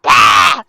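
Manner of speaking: sudden loud mock scream; short, loud acted freak-out; crazed yell. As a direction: burst out yelling like someone going crazy. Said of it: "it's just going crazy".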